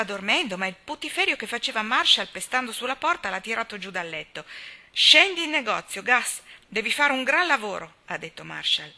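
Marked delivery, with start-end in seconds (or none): 4.9-8.0 loud, high summons; calling out. A narrator's reading. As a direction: call up to rouse a sleeper. Marshall sveglia il barbiere gridando. (richiamo perentorio a gran voce)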